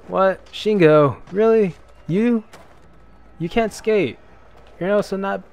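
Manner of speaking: high, loud disbelief; shocked outburst at the rival; surprised. Real, not acted.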